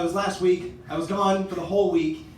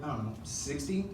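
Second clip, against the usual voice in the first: sheepish guess; 6 semitones lower, 10 dB quieter than usual. Retold or imitated. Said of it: "And I was like".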